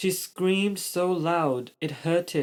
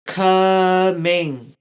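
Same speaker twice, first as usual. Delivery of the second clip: slow, drawn-out higher coming; said slowly; 4 semitones higher, 6 dB louder than usual. A narrator's reading. Baby dragon Rascal saying its one word, slow and drawn out. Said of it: "Rascal said slowly".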